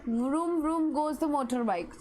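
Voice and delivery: reading the example slowly; slow, deliberate example sentence. Shown as a demonstration. Teacher to class, reading the example slowly. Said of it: "I will read one more time".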